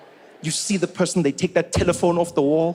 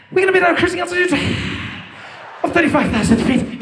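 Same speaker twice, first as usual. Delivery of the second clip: breathy pilot voice; 11 semitones higher, 3 dB louder, faster than usual. Retold or imitated. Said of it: "Every time that they're like".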